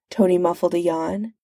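usual speaking voice

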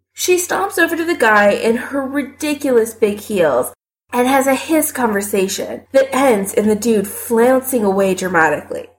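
ordinary delivery

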